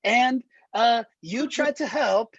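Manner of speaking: put-on high Borat accent building. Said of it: "I do the Borat".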